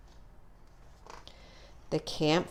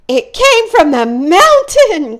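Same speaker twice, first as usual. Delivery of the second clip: much higher, louder panicked cry; frightened cry; 12 semitones higher, 11 dB louder than usual. Acted. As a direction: cry out in terror at the mountain. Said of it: "cried Mitzi".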